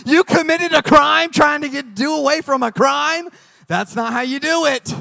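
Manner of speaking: loud, high-pitched exasperated protest; mock incredulous scolding. Husband hearing his wife fled a cop, mock scolding. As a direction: scold her in hammed-up disbelief.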